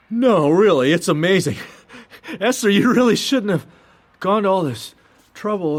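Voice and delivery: high, eager, laughing thanks; overwhelmed by her kindness; flustered gushing. Acted.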